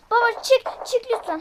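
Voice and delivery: loud, high pleading call; urgent shout. Real, not acted. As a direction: beg him loudly to get out. Urging dad to get out.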